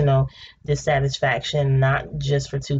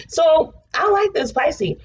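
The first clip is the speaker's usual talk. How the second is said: excited; 12 semitones higher, slower than usual. Real, not acted. Excited about the reading.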